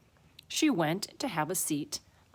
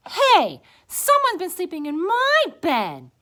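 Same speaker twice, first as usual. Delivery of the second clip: high, loud, indignant she-bear; Mama Bear; 12 semitones higher, 11 dB louder than usual. A narrator's reading. Mama Bear looking at her bed, high loud voice. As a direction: cry out high and offended. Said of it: "Mama Bear looked at hers".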